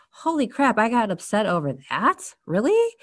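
high-pitched disbelieving realization, rising sharply on "Really"; mock incredulity. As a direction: act out stunned disbelief at yourself. Acted sudden realization of overreacting.

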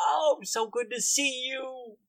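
high, slow drawn-out mock welcome; hammed-up delighted greeting; gloating bro boss. Guildmaster greets captive, pounding desk.

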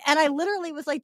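normal talking voice

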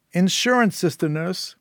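high, forceful reminder; taunting Syl. Acted. Syl refusing to return the child, high and taunting.

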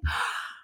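short acted gasp of shock; mock gasp. Wife reacting to forgetful husband, mock gasp. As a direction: gasp in play outrage.

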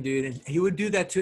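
ordinary talk, nothing marked

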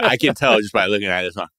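ordinary talk

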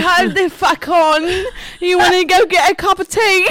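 high, loud mock English accent; hammed-up English tea invitation. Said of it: "I like your English accent".

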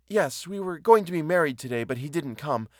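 lightly voiced woman, high then sinking; a touch of distressed confession; mildly flustered admission; embarrassed woman.

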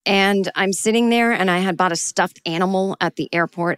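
ordinary delivery

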